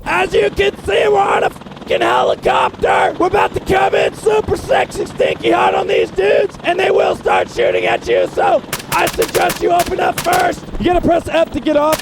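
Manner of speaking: high, loud yell over rotor noise; shouting over noise. Real, not acted. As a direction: shout to be heard in the chopper. Yelling over helicopter noise.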